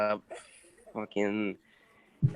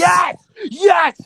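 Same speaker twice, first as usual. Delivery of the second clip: much higher and louder cheer; triumphant shout; 14 dB louder than usual. Acted. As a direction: yell in victory. Celebrating the award result.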